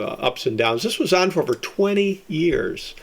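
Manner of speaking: ordinary talk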